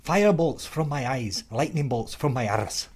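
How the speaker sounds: declaiming in a put-on Scottish accent; mock-heroic Scots proclamation; William Wallace. Quoting the movie for the co-host, Scottish accent. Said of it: "that's a pretty good accent".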